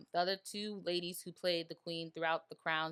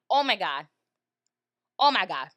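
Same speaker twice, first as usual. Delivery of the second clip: loud, emotional oh my God; overcome exclamation; 13 dB louder than usual. Real, not acted. Telling viewers about the show's last episode, loud and overcome. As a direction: burst out overwhelmed by the scene. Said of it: "because I'm crying".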